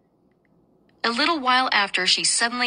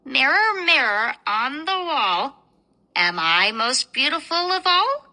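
slow, higher queen calling the mirror; vain queen voice; 4 semitones higher than usual. Acted. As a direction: ask the mirror, slow and proud. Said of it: "she could not help saying".

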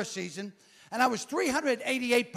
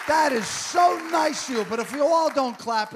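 louder and higher, pushing the crowd; prompting applause; 4 semitones higher, 6 dB louder than usual. Real, not acted. Comic nudging the crowd to clap, loud. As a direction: demand the clap with a grin.

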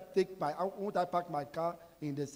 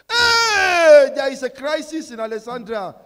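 imitating a panicked cry, higher and louder; hammed-up alarm raised to the whole street; mock alarm; 6 semitones higher, 14 dB louder than usual. Preacher mimics panicked neighbours in mock alarmed cry. Retelling alarmed warnings from people.

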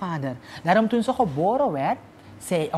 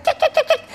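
hair-pulling noise; much faster than usual. Acted.

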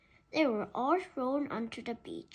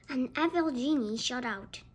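ordinary delivery, nothing marked

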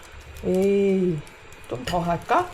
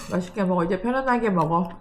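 usual speaking voice

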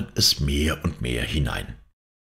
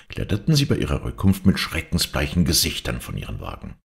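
ordinary delivery

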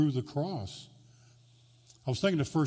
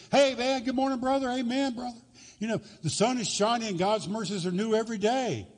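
hammed-up church cheer; 9 semitones higher than usual. Churchgoer greeting brother at the door, hammed-up high cheer. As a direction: overplay the happy churchgoer mask.

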